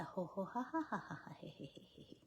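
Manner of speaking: forced ho-ha laugh fading to a whispered he-he; fake laugh. Shown as a demonstration. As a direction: laugh on purpose, then let it trail off into breath. Demonstrating laughter practice.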